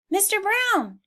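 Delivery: high call for the horse. Acted. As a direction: call across the yard. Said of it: "he called".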